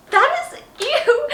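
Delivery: high, loud laughing disgust. Real, not acted. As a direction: squeal ew through a laugh. Disgusted at old diary.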